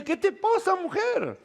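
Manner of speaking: high, strained helpless king's cry; the king at his wits' end, throwing up his hands; frustrated king. Acted. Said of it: "él le dice".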